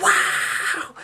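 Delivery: a whispered gasp of wow; retold awe, breathed out without voice; breathless awe. Opening the box of videos, a breathy whispered wow. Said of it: "We're like".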